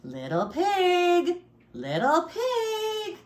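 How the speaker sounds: high, drawn-out singsong call. A narrator's reading. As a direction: call for the pig, stretching each word. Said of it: "so I called".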